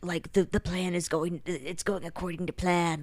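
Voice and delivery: softly voiced mock schemer; retold nefarious plotter. Right-hand man plotting on the phone, hushed mock scheming. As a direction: lightly play a plotter, keeping it quiet. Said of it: "saying something nefarious".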